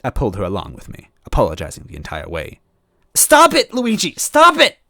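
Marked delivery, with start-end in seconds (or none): 3.1-4.8 put-on high, loud girlish cry; Toadette crying out. Toadette crying out as Luigi drags her away. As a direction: cry out struggling against him. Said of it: "she cried".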